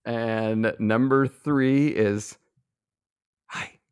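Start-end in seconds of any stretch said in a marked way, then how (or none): 3.5-3.8 tiny, very soft whisper. Shown as a demonstration. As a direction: whisper a greeting, barely audible. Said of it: "my five whispered words".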